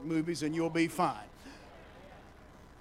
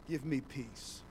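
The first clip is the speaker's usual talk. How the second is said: lower, softer plea for peace; soft prayer; 6 semitones lower, 6 dB quieter, slower than usual. Shown as a demonstration. Preacher modelling a prayer for the congregation, quiet. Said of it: "let's say it in here".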